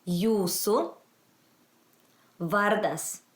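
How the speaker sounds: Jūsu rising, long pause, vardas falling; language-teacher model. Shown as a demonstration. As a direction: teach Jūsu vardas one word at a time. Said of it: "let's go that again".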